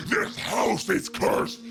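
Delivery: hoarse, slow ranting doomsayer, mock screamed; mock screaming preacher woman. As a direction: play the street prophet howling curses. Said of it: "she's screaming".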